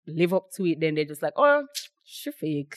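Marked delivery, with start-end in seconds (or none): 1.4-2.8 higher, slow, put-on sneering voice; mocking disdain. Mimicking people who call her fake, slow sneering voice. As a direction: drag it out like a snide gossip.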